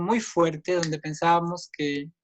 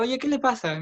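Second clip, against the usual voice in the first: higher-pitched indignant challenge, lightly acted; retold indignation; 7 semitones higher than usual. Victim confronts man who threw a cap, mild indignant protest. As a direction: challenge him as if affronted.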